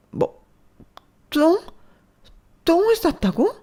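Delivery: very high, loud shocked shriek; shrieking disbelief. Acted. Mother hearing the shameful detail, shrieking. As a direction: screech in disbelief.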